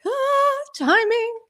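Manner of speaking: very high-pitched little yelp; mock nervous panic. Solo host recalling hitting the intro cue, mock panic. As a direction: replay the jitters in a high, squeaky voice. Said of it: "I was more nervous".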